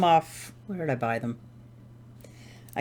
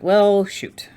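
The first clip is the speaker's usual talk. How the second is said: higher, louder mock dismay; mild exasperation; 6 semitones higher, 6 dB louder than usual. Knitter recalls protector popping off, mildly exasperated. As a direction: re-enact a mild "oops" with raised pitch.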